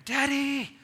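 put-on high, loud little boy calling; child crying out. Father imitating his son calling from bed, high child's cry. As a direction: call out like a small son waking at night. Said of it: "I hear".

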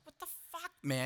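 squeaky, high, breathy disbelief. Retold or imitated. Friend bragging about nudes, high squeaky disbelief. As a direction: squeak it out in outrage. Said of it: "I was like".

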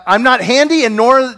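ordinary delivery

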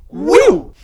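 a high whoosh that swoops past and falls away; passing by doppler. Shown as a demonstration. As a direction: Doppler whew, high then dropping. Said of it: "We're gonna cut now to the Doppler effect".

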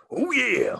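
high, gruff wrestler growl; hammed-up Macho Man; Macho Man impression. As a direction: ham up the wrestler catchphrase. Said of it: "That was Macho Man".

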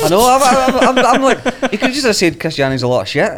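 loud, high, talking through laughter; barely getting words out for laughing; laughing hard. Real, not acted. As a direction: stammer it out while cracking up. Cracking up at long explanation.

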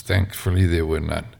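plain reading voice

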